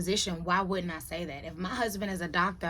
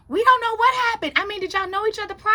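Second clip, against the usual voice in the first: high, fast imitation of gossiping coworkers; hammed-up, rapid-fire questioning; acted-out bafflement; 11 semitones higher, 6 dB louder, faster than usual. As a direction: mimic puzzled coworkers in a raised voice.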